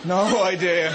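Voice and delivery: usual speaking voice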